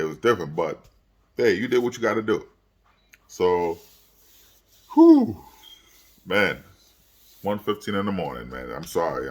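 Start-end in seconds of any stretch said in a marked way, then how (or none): 4.9-5.4 a big high exhaled whew, worn out; loud whew. Real, not acted. Vlogger at one fifteen, exhausted after the game, loud whew. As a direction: blow out a long tired whew.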